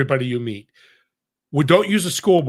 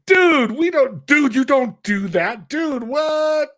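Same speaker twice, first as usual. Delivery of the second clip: high, drawn-out surfer voice, pitched way up and loud; surfer dude impression; 11 semitones higher, 5 dB louder, much slower than usual. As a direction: play the stunned laid-back surfer clerk. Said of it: "a surfer dude".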